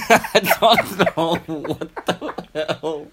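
high laughing words. Real, not acted. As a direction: talk through giggles. Laughing after absurd line.

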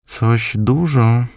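high, very slow grandmother's murmur; grandma whispering. A narrator's reading. Grandmother weighs the offer, high and very slow. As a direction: muse it over slowly. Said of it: "szepnęła w końcu".

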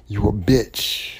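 low, slow mock insult; hateful insult. Shown as a demonstration. Showing how he doesn't mean the word, low hateful insult. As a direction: drag it out low, demonstrating a nasty put-down. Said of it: "It's not hateful. It's not like".

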